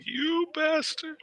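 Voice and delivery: high, slow, soft mock outrage; mock fist-shaking curse. Imagining shaking a crippled fist at the driver, feeble mock anger. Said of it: "shaking my crippled fist like".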